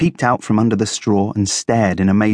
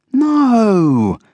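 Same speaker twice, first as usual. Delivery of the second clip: high, long drawn-out no; high-voiced, strained patience; suppressed exasperation; 13 semitones higher, 4 dB louder than usual. A narrator's reading. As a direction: stretch it out, holding back exasperation. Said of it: "tried not to sound exasperated".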